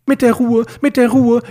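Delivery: MIT DER RUHE thrown back high and loud; shrieky, exasperated echo of calming words; indignant echo. A narrator's reading. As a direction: repeat the phrase in outraged mockery.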